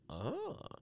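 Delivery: a breathy gasp of being won over; mock awed sigh. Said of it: "The four was like".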